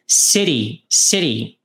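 "City" with the T flapped like a quick R, sounding like "ciri"; American city. Shown as a demonstration. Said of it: "que tem som de R". ("City" com T de som de R, tipo "ciri")

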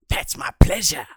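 breathy whispered payoff. Acted. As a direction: drop to a sly whisper.

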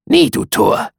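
very high, loud bird cry; raven's refrain. A narrator's reading. Raven refuses the narrator's plea, screeching high. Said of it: "Sprach der Rabe".

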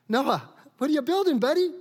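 high, fast mocking call to Noah. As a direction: tease him like a neighbour taunting.

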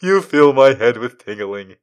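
high, loud, gooey cartoon voice; lovestruck cartoon boy. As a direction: stammer it like a smitten cartoon teen. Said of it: "adolescent boy says when he's got hearts".

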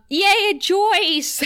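high, loud delighted squeal of her name; gleeful yay; excited cheer. Real, not acted. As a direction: cheer like your favorite just walked on screen. Cheering a favorite character's entrance.

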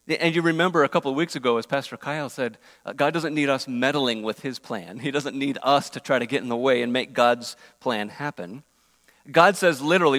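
normal talking voice

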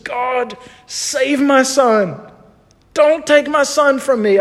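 high, strained, drawn-out plea to God; retold screamed prayer. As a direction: re-enact a desperate father begging, without full screaming. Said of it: "I just screamed".

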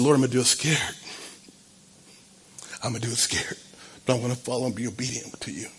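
low, very soft retold prayer; subdued, humble vow; hushed surrender. Retold humble answer to God.

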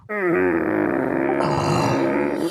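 long, low exhaled sigh; resigned sigh. Acted. Cat reluctantly lets the kobold go, sighing. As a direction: let out a drawn-out sigh of surrender.

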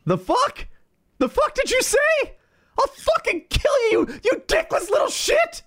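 very high, screeching mock fury; hammed-up outrage. America reacting to a threat on Canada, mock screaming fury. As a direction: explode into cartoonish shrieking rage. America enraged in dramatic reading.

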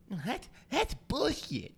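high mocking complainer voice; imitating a muffled complainer. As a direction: mimic the masked guy's grumble. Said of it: "he goes".

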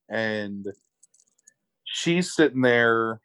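ordinary talk, nothing marked

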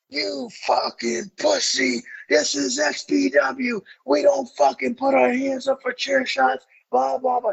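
slow, high furious yell; imitating an irate promoter; irate boss. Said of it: "Rob goes irate".

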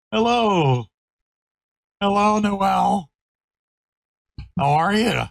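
high, sing-song puppy talk. Real, not acted. Cooing at the puppy.

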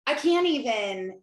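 overwhelmed mom hamming up defeat; exasperated I can't even. Retold or imitated. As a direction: drag out "I can't even" like you're done with it all. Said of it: "I'm like".

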